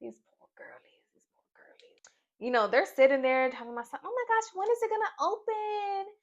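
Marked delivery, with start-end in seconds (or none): 0.0-2.0 pitying whisper. Real, not acted. Vlogger pitying the girls, whispered aside. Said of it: "I'm just like". 4.1-6.1 high giddy mock chatter; mock girlies. Vlogger voicing the girls waiting for the box, high.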